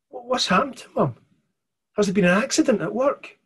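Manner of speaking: put-on a scared child demanding answers; frightened girl. Mirren asking the stranger about her mum, frightened.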